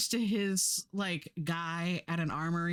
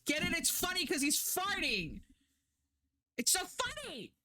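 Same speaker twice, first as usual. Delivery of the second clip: sarcastic mockery; 10 semitones higher than usual. Reviewer ridiculing the show's fart gag, high and mocking. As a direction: sneer at the cheap fart gag in a high mock voice.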